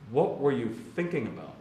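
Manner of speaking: ordinary talk, nothing marked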